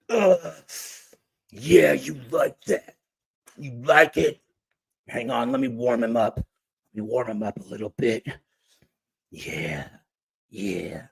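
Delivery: hammed-up creep, slowing and sinking low; sleazy coked-up man. Coked-up guy talking to a girl in a hotel, sleazy.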